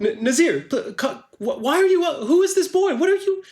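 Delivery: very high, stammering alarm; flustered. Acted. Tigzir spots Nazir with a strange boy, flustered. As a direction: sputter questions in a high-pitched voice.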